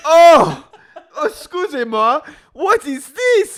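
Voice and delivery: high, loud mock French outrage; French chef. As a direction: gasp like an appalled French chef. Mocking French brand staff.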